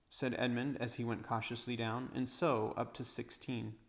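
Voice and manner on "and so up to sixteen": ordinary delivery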